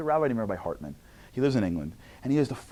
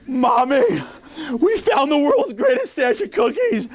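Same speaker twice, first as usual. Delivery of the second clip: put-on high, loud, slow thrilled child voice; thrilled kid; 9 dB louder, slower than usual. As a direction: marvel like a kid at treasure.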